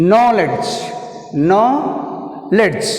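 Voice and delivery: "Knowledge" with a held KNOW, then a fall; teacher modelling "Knowledge", stressed at the start; first-syllable stress. Shown as a demonstration. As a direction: press KNOW, drop the end of "Knowledge". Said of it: "Stress is first syllable K".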